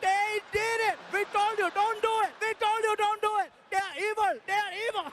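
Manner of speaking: put-on high, loud accented yelling; yelling Indian crowd. Indian audience yelling at the Germans, high and panicked. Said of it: "They were yelling".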